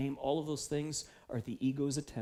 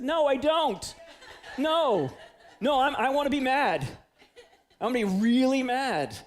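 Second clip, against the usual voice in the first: loud, stubborn laughing no, higher and louder; stubborn mad refusal; 9 semitones higher, 7 dB louder than usual. Retold or imitated. Preacher retelling his sulk after a racquetball loss, loud stubborn laughing refusal. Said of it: "And you know what I said?".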